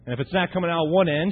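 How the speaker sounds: normal talking voice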